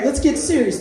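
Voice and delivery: ordinary delivery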